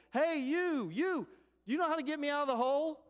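very high, loud shout from below; stretched, high-pitched call from a pit; yelling for help. Retold or imitated. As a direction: holler up to a passing stranger. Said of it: "he yells at him".

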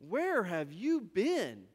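slow, drawn-out disbelieving question; incredulous. Acted. Travelers to the stranger who missed the news, incredulous. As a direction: stretch each word in disbelief.

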